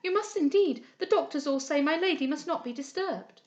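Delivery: urging maid. A narrator's reading. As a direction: coax her gently out the door. Maid urges visitor out.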